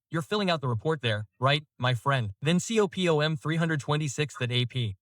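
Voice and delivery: ordinary talk, nothing marked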